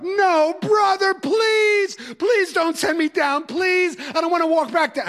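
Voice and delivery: mock-wail like a desperate kid; imitating a teen wailing at his teacher not to send him downstairs; wailing plea. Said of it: "the wailing would start".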